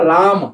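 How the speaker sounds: राम with a long, drawn-out रा; stress on रा. Shown as a demonstration. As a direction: lean on the रा in राम, slowly. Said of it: "जोर पर रहेगा".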